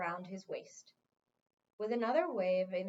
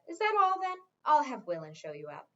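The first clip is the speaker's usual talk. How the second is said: impatient dismissal; 3 semitones higher, 7 dB louder than usual. Acted. King impatiently dismissing the wizard.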